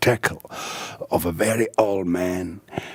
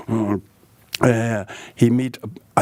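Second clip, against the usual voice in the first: ordinary talk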